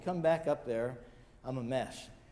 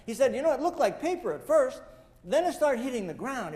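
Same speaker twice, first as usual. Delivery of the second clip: higher and louder, caught up in the memory; animated retelling; 6 semitones higher, 4 dB louder than usual. Retold or imitated. Policeman recalls the towers coming down, agitated and animated. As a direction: pour it out like it's happening again. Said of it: "He got all animated".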